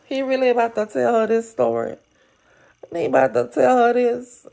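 can't believe what he's about to do; outraged disbelief. Real, not acted.

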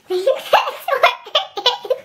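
giggling through the words; laughing at herself. Real, not acted. Laughs at own eyebrow.

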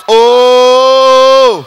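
drawn-out loud held cry; long loud "ooo". Acted. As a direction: hold one long, loud exclamation. Long loud exclamation after punchline.

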